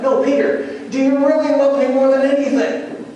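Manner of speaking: high, pressing, insistent question; rising voice. Retold or imitated. Jesus pressing Peter about his love, high and urgent. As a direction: push harder, pitch climbing right up. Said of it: "his voice rose each time he asked him".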